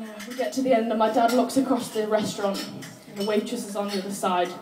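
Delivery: usual speaking voice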